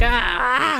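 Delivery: short high cry of panic; a mock panicked yelp. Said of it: "I was losing my mind".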